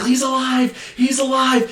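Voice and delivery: high, thrilled exclamation repeated; overjoyed mad scientist; joyful cry. Retold or imitated. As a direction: shout it like you can't believe the good news. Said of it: "he's like".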